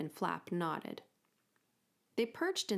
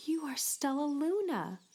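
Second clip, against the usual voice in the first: mild whisper; 9 semitones higher than usual. Acted. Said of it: "she whispered".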